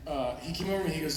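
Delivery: ordinary talk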